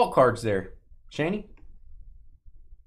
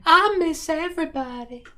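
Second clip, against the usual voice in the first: Southern belle; 13 semitones higher than usual. Pretend. Teasing chat about Atlanta's Southern belles, high Southern belle voice. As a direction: gush it like a Georgia Southern belle. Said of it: "Everybody talked like that".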